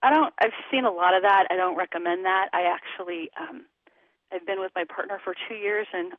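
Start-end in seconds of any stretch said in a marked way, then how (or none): none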